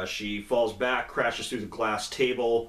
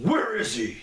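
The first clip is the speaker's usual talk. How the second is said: mock demand; 9 semitones higher than usual. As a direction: bark Where is he? like an interrogating Batman. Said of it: "it ends with him going".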